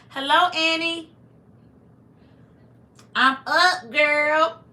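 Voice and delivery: high, loud, drawn-out hello; big, high-pitched shout-out to a viewer; calling out greeting. Real, not acted. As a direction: sing out a greeting to a friend. Calling out to arriving viewer Annie.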